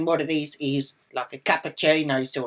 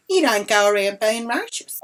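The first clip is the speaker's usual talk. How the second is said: higher, louder mock rebuke; scolding woman, imitated; 7 semitones higher, 5 dB louder than usual. Bystander accusing her of racism, mock rebuke. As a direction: imitate an indignant bystander, pitched up.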